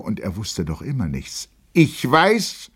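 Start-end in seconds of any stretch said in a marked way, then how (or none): 1.7-2.7 much higher, rising and left hanging; insistent repeated start. A narrator's reading. Lieutenant steps forward to address the room, insistent start. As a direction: press the opening words out, unfinished. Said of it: "wiederholte er".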